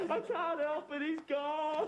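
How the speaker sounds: high, tearful wail; comically overdone sobbing; crying. Acted. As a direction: bawl helplessly through the words. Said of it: "don't cry".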